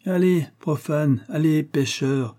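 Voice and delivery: abbé grave qui sermonne, voix plus aiguë; prêcher comme un vieil abbé érudit; voix de l'abbé. Acted. L'abbé reprend. (l'abbé fait la leçon au chevalier, en sermonnant)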